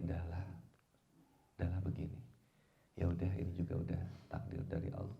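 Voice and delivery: low, soft acceptance; subdued, accepting self-talk; quiet resignation. Acted. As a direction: let it go, dropping pitch and volume. Voicing acceptance instead of endless complaining.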